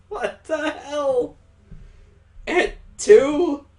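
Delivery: high, drawn-out disbelief; shocked, voice shooting up; indignant shock. Acted. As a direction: protest in high-pitched outrage. Indignant victim of the kisses.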